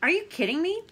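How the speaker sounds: high, loud mock disbelief; can't believe what you heard. Characters arguing in book.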